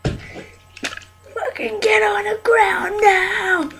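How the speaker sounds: barking cop orders, high and loud; shouted surrender demands. Acted. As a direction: yell like an angry cop. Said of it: "I'm a police officer".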